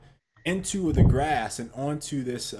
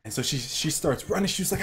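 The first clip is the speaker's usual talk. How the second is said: ordinary talk, nothing marked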